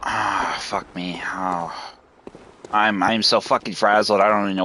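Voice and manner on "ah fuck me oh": drained mutter. Real, not acted. Frazzled after fight.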